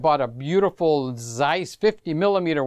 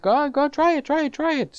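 eager urging; 9 semitones higher than usual. Retold or imitated. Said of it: "And he said".

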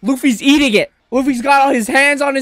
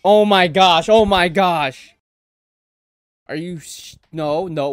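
ordinary talk

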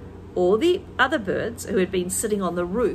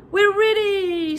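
monkeys; 11 semitones higher, 6 dB louder, slower than usual. A narrator's reading. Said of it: "said the monkeys".

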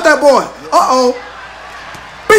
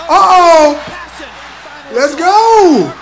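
ordinary talk, nothing marked

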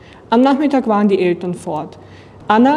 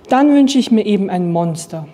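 usual speaking voice

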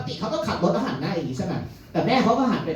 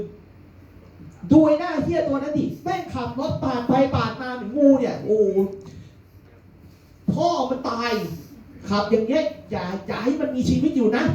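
much higher, slow vengeful rant; mother's fury; 8 semitones higher, slower than usual. Retold or imitated. Mother urges son to go after the driver, much higher slow rant. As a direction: rant up high, drawing it out.

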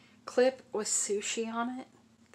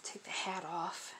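whisper; 9 dB quieter than usual. Real, not acted. Quietly unboxing a doll.

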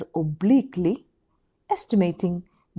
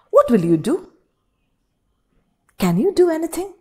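louder, rising scornful dare; angry challenge; 8 semitones higher, 5 dB louder, faster than usual. Acted. Wife challenging husband mid-quarrel, louder and higher. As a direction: taunt him.

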